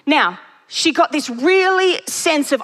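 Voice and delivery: normal talking voice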